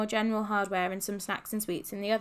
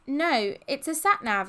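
slow, raised, louder explanation; explaining; 5 semitones higher, 5 dB louder, slower than usual. A narrator's reading. Ben setting Polly straight about the screen, explaining. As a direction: spell it out patiently. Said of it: "explained Ben".

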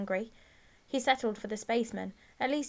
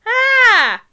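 loud, high yell of pain; piercing pained cry; shout of pain; 21 dB louder than usual. A narrator's reading. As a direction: yelp out loud as if grabbed by the ear. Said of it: "he let out a shout of pain".

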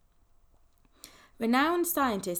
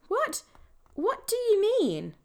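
surprised; 13 semitones higher, 5 dB louder, slower than usual. Acted. Ben startled by outburst.

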